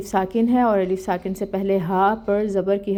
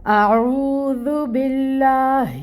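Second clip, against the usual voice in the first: slow, higher chanted tilawat; Quran recitation; 5 semitones higher, much slower than usual. Shown as a demonstration. Teacher opening the lesson with a slow chanted recitation.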